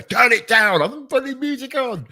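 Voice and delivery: high-pitched complaining yell; mock yelling. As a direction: holler it high, as if at the neighbours. Imagined partner yelling at neighbour.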